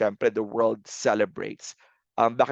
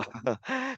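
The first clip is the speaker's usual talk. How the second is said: brief laugh; 13 semitones higher, 8 dB quieter than usual. Real, not acted. Laughs at outdated question.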